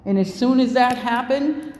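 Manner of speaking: ordinary talk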